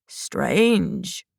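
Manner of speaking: put-on soft, low old man coo. A narrator's reading. Old man muses about Shang, soft low coo. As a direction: croon the word to himself. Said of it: "the old man cooed".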